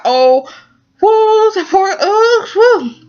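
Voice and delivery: high-pitched sympathetic cooing; high "woo" of heartache; pitying wail. Real, not acted. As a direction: wail with pity for the poor character. Moved by the crying scene.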